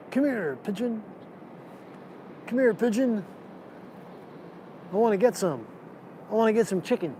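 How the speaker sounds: soft, lightly voiced lovesick pigeon. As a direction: coax like a pigeon chasing its mate. Voicing a chasing pigeon.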